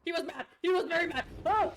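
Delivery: high, loud panicked exclaim; real alarm as he turns on her; alarmed yelp. Real, not acted. Gamer startled when a character attacks, alarmed.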